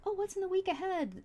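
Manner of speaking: high, lilting mock-innocent asking; mock innocent question. Reader imitating their own question about the week, mock innocent. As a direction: pitch it well up, playing blithely curious.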